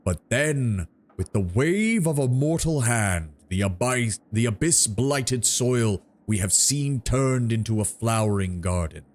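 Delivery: slow, solemn tree giant; treant voice. Acted. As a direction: draw each word out like an ancient tree. Treant's oration.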